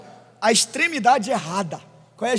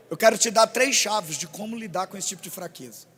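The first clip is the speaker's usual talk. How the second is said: ordinary talk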